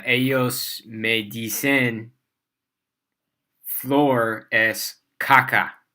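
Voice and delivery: each word laid out slowly and deliberately; slow careful Spanish. Real, not acted. Teacher slowly telling her students a story in beginner Spanish. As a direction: speak like a learner minding every syllable.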